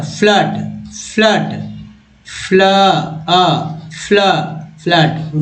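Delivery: फ्लड broken into फ्ल and अ, then rebuilt; फ्ल, अ, फ्लड syllable by syllable; sounding out. Shown as a demonstration. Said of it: "फ्लड में double ओ का उच्चारण क्या होता है".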